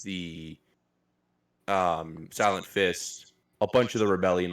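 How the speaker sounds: ordinary talk, nothing marked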